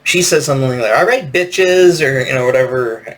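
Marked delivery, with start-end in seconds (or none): 1.0-2.1 put-on drawn-out, higher, louder party girl call; mock a party girl rallying her friends; slow, raised party girl voice. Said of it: "party girl voice".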